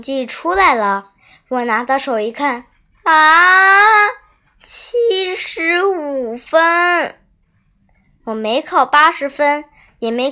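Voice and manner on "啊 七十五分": high, loud drawn-out gasp, then slow, high score; shocked cry. Acted. (震惊地拖长声音惊叫)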